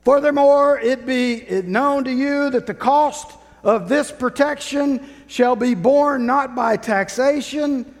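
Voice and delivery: loud, high royal proclamation; town crier. Acted. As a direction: bellow the decree to the square. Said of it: "And then he would say".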